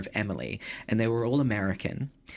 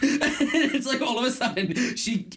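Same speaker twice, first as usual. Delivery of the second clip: laughing; 11 semitones higher than usual. Real, not acted. Laughing while retelling.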